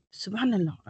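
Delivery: soft, stunned exclamation; hushed disbelief; shocked reaction. Retold or imitated. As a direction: say it under your voice, taken aback. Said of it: "I was shocked".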